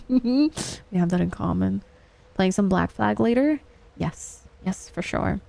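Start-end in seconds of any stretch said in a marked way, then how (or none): none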